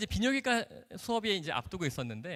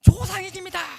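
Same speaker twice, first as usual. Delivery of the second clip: high, very loud acted-out shout; imitated mass yell; crowd shouting in unison; 15 dB louder than usual. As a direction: belt it out like a whole class at once. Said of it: "정말 백삼십 명이 동시에".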